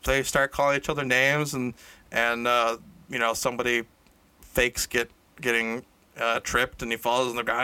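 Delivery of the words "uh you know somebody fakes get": normal talking voice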